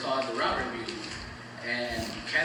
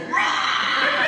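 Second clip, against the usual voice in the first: imitated scare shout; 5 dB louder than usual. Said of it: "and she'd go".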